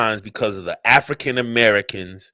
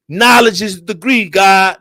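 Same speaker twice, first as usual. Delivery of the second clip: high, raised-voice hail; raised salute; 4 semitones higher, 4 dB louder than usual. Real, not acted. Speaker saluting God with a slogan, raised high hail. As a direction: proclaim it up high and strong. Hails, then repeats it more quietly.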